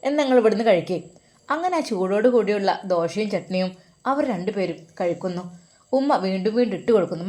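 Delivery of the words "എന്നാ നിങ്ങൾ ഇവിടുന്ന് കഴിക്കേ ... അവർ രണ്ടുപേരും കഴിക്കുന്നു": ordinary delivery, nothing marked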